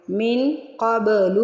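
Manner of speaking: من قبل very slow, nasal hidden noon, bounced ba; slow teacher model of من قبل; ikhfa and qalqalah. Shown as a demonstration. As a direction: drag it out, hide the noon. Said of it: "اب مین پہ اخفا کر لیں".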